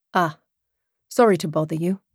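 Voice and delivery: put-on polite British-accented apology; lightly voiced British man backing off. A narrator's reading. Rebuffed man apologises to the woman, British accent. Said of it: "that lovely British accent".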